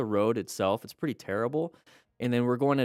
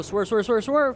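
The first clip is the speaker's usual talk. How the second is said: urgent callout; 11 semitones higher, 6 dB louder than usual. Real, not acted. Urgent callout to rider in clip.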